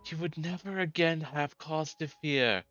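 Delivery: high, restrained pompous voice; high-pitched would-be tyrant with no vocal power; unable to raise voice. Acted. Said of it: "this guy cannot raise his voice".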